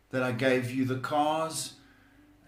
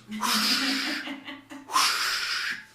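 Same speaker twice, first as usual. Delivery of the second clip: gloating laugh; 13 semitones higher than usual. Pretend. Mock rich persona laughing.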